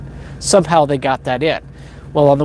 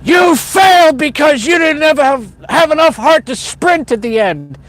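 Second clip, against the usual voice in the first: much higher, louder barking yell of a drill sergeant; 11 semitones higher, 5 dB louder than usual. Retold or imitated. Senior drill sergeant yells at a recruit after the run, loud high shout. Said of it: "yell to me".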